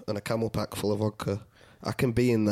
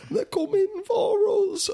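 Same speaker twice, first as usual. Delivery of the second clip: much higher, slow and trembling; tearful warning; slower than usual. Retold or imitated. Mimicking the teary veteran's doom warning, high and slow. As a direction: choke it out like he's about to cry. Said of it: "started to tear up".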